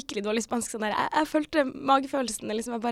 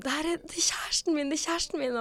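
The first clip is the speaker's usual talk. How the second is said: excited calling out; 6 semitones higher than usual. Retold or imitated. Telling the bus passengers he is her boyfriend, mock excited shout. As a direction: squeal it to the whole bus, held back in volume. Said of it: "så bare ropte jeg på bussen sånn".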